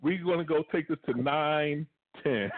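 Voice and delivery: normal talking voice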